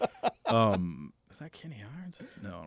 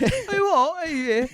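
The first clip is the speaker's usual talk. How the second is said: very high, louder mock British noises; mock British accent; 5 dB louder, much slower than usual. Co-host demonstrating his wandering British accent, high mock British. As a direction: squeal vague British syllables, half laughing. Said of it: "that's how you do it".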